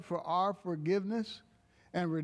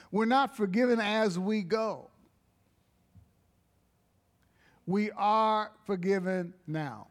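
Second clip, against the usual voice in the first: raised, emphatic preaching voice; 4 semitones higher, 3 dB louder than usual. Acted. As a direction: build the point to the congregation. Preaching with rising force.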